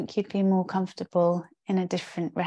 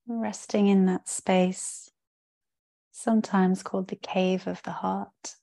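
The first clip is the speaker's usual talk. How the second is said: guided meditation. Real, not acted. Yoga teacher settling the class into rest, calm guided-meditation voice. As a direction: guide them into rest, with spacious pauses between phrases. Leads a yoga nidra practice.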